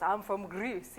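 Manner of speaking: a hint of a father's deep voice; slightly put-on deep dad voice. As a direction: lightly imitate dad's deep voice. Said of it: "in his deep voice".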